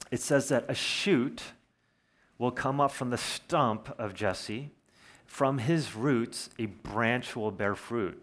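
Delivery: slow, deliberate reading of a verse; measured Bible reading, unhurried; scripture reading. Acted. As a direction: lay each phrase out slowly like scripture. Reads Isaiah verse slowly.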